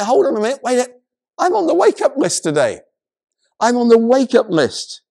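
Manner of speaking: acted high, loud astonished outburst; excited realization. Lazarus in heaven hearing his name, acted excited outburst. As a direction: pitch it way up, comically eager.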